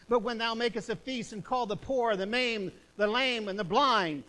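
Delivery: loud, preached Bible verse; forceful scripture reading. Acted. Preacher reading Luke to the congregation, declaiming. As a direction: proclaim the verse to the church.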